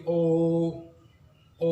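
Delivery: ओ held long and smooth; लंबी ओ की आवाज़; drawn-out O. Shown as a demonstration. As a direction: stretch the ओ slowly. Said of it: "वो लंबी हो जाएगी".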